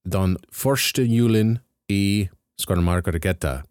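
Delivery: title said in a careful Norwegian accent; best Norwegian accent. Shown as a demonstration. Host trying the Norwegian film title in a Norwegian accent. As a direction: sound it out in Norwegian, doing your best. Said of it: "with my best Norwegian accent".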